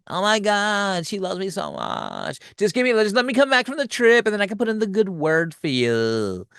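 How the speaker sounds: high, put-on best-friend voice; gushing bestie.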